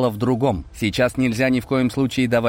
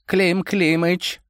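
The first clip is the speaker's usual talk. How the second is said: put-on high, slow old man call; Koshchei calling; 9 semitones higher, much slower than usual. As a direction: draw out the name gently. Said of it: "обратился внезапно к нему Кощей".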